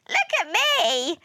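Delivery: high, gleeful cry; excited showing off. A narrator's reading. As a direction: call for everyone's attention. Neptune showing off backflips.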